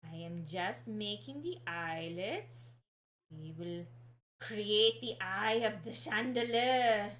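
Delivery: slow, hammed-up Young Frankenstein accent; slow, muddled Young Frankenstein movie accent; bad Young Frankenstein accent. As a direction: badly fake a Young Frankenstein mad-scientist accent, drawn out. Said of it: "I don't know what accent that was".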